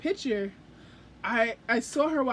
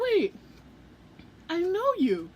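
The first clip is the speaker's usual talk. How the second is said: much higher, startled realisation; sudden recognition; 6 semitones higher than usual. Retold or imitated. Fan recognising [name], high startled surprise. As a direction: catch yourself mid-sentence in surprise.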